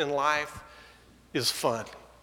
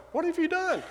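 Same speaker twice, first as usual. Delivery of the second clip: much higher, mock accusing dog voice; Tex the dog; 14 semitones higher than usual. Tex the dog to his owner outside, high mock accusation. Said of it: "Tex was standing outside our door going".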